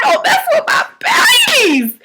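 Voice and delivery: very high, drawn-out protective cry; hammed-up outraged squeal, stretching the words; losing it. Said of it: "I was losing it".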